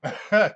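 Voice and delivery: short high, loud laugh; sudden high chuckle. Real, not acted. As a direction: burst out laughing. Laughs at chat comment.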